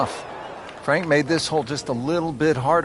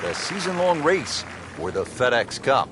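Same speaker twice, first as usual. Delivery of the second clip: proud, higher broadcast intro voice; TV announcer; 4 semitones higher than usual. Acted. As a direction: open the show like a network announcer.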